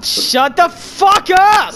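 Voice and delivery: exasperated, stretched-out yell; loud retort. Real, not acted. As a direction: yell it slowly to silence a teammate. Snaps back at teasing teammate.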